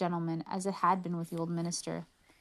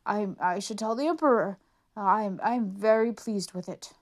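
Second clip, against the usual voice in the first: lightly voiced flustered old minister, a bit louder; a fumbling courtier covering his blindness; 5 dB louder than usual.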